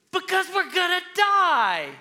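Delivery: high, loud, squeaky panic voice; panicked Peter. Retold or imitated. Pastor voicing Peter yelling back at Jesus in a high panicked squeal. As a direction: squeal it like a terrified fisherman. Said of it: "maybe it's just Peter, who's like".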